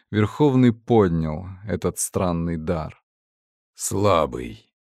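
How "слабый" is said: low, hollow dismissive voice; whispery telepathic voice. Acted. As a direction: judge the stone coldly, low and hollow. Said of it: "сказала маска".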